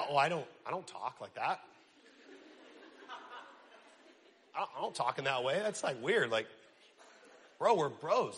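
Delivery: low, soft, uncomfortable protest; acted sheepish mumble, pitched well down; awkward reluctance. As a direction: play a guy squirming out of saying something nice. Acted friend balking at giving praise.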